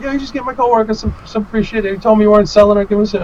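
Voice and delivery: high, quick indignant complaint; replayed heated demand; retold confrontation. Retold angry confrontation at booth.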